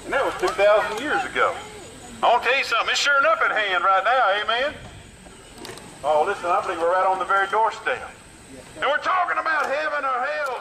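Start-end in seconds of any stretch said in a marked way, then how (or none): none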